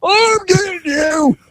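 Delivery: high, slow, strained shout; officer shouting at the fleeing suspect while restrained; furious yell. Retold or imitated. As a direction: yell while being held back. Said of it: "he had to physically restrain him".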